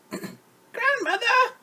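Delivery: put-on wolf faking a little girl; wolf's little girl voice. Wolf at grandmother's door pretending to be Red, falsetto. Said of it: "in his best little girl voice".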